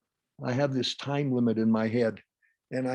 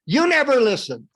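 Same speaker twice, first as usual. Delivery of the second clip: loud, high accusation; angry shout; 10 semitones higher, 9 dB louder than usual. Acted. Critical parent accusing the listener, angry shout. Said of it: "if you wouldn't shout at me".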